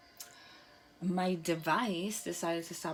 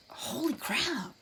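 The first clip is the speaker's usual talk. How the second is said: amazed; 8 semitones higher, much slower than usual. Real, not acted. Timeline revelation.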